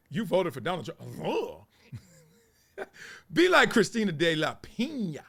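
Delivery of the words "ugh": high imitated rapper grunt. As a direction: punctuate it with a rap ad-lib "ugh". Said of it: "sound like [name]".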